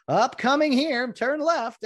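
high, slow announcer voice; bad sportscaster GPS voice; terrible Vin Scully impression. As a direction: drawl it out like a ballgame announcer. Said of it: "I'm a terrible Vin Scully impressionist".

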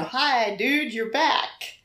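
slow, drawn-out friendly hello; Tony's greeting. Retold or imitated. Wife retelling husband greeting angel, slow drawn voice. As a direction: stretch out a dreamy greeting.

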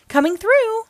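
put-on high, loud child calling; little girl racing past the star; playful warning shout. A narrator's reading. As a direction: clear the way like a zooming kid. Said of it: "warns Melody".

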